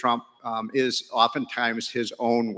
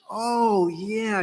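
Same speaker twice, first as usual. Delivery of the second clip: higher "oh" of sudden recognition; the moment it clicks for her; woman realizing; 9 semitones higher than usual. Retold or imitated. The woman finally recognizing him, higher and delighted. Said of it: "the light bulb went on".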